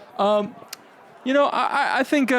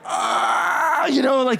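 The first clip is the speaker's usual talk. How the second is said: anguished cry; 7 semitones higher, slower than usual. Acted. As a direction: cry out in pain, then trail into talk. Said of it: "pretty accurate impression".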